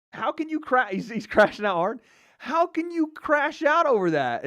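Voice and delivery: high incredulous exclaim; baffled. Real, not acted. Streamer reacting to the fighter's meltdown, high baffled exclaim. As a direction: ask it in disbelief.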